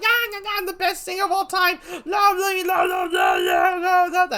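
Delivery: high screeching singer parody; hammed-up top-of-the-lungs bragging; mock screaming. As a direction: yell shrieky nonsense like a vain singer. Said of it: "scream at the top of his lungs and yell at them like".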